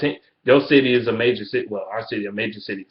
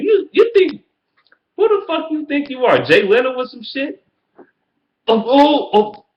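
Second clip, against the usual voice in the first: high incredulous rant; 12 semitones higher than usual. Real, not acted. Ranting at the Bears YouTuber, high and incredulous. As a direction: voice jumps way up in disbelief.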